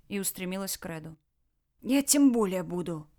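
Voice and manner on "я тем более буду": a gruff, grudging mutter; grumbling. Acted. Red to the woman checking injuries, grumbling. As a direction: grumble to brush off the fuss. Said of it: "проворчал тот".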